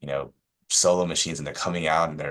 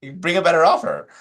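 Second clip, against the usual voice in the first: high, loud, hammed-up indignation; mock-wounded protest; 12 semitones higher, 7 dB louder than usual. Agent protesting to a colleague about being yelled at, mock-wounded. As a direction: wail it like a comically wronged party.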